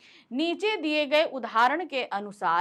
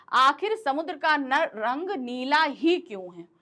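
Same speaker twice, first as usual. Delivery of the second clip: Neela ही slowed and pressed; puzzled question stressing Neela; 2 semitones higher than usual. Shown as a demonstration. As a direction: dwell on Neela ही. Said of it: "नीला शब्द के ऊपर pressure देने के लिए".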